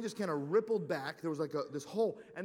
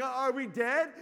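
retold shout; 6 semitones higher, 7 dB louder, slower than usual. Scared boys in the crater.

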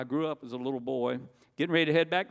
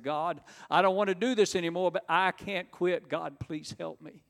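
higher-pitched plea, slowing and softening to the end; desperate plea; 4 semitones higher than usual. Retold or imitated. Looking up to heaven ashamed, desperate plea. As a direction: beg for help, drawing out the last words. Desperate prayer after a relapse.

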